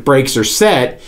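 normal talking voice

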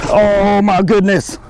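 loud, drawn-out amazed cry, pitched high. Real, not acted. Angler seeing the monster fish surface, amazed. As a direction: stretch out the awe, high and loud. Stunned at sight of huge fish.

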